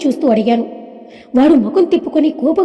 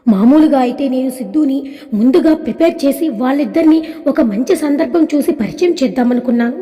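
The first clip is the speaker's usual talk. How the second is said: plain reading voice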